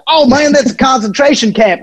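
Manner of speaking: high, hammed-up vendor voice; mock vendor. Vendor explaining the shirt to the fan, high and mocking. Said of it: "the guy was like".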